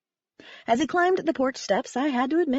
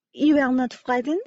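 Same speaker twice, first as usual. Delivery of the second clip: put-on French-accented puzzled question, rising; calmer French accent; 3 semitones higher than usual. A narrator's reading. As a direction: ask it with a French accent, panic eased. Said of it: "calmer now as I untangled Ding".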